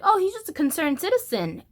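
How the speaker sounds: high, light and forced cheerful; a mock-cheery inner voice; trying to be positive. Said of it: "So I'm trying to be positive".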